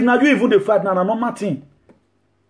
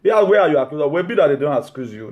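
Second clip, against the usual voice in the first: ordinary talk, nothing marked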